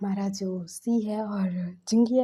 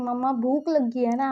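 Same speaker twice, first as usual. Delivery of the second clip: put-on a touch of a hungry child's whine, slightly louder; whiny kid; 2 semitones higher, 4 dB louder than usual. Hungry son nagging his mom for food, a light childish whine.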